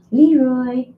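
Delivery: calling out to the sleeping kitten; calling the cat. Real, not acted. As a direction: call the kitten's name up and out.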